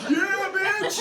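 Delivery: high, loud triumphant yell; hyped shout. Retold or imitated. Son cheering his mom on, loud high yell. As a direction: cheer like a fan going wild.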